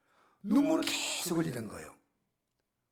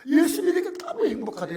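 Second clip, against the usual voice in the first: joyful laughing; 13 semitones higher, 9 dB louder than usual. Real, not acted.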